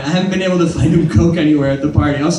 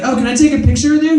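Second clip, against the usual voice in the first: put-on eager fan girl; 8 semitones higher, faster than usual. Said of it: "And she's like".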